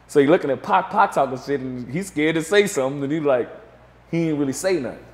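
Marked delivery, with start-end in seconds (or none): none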